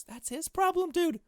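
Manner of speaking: very high, loud and scoffing; loud, high scoffing outburst; dismissive squeal. Real, not acted.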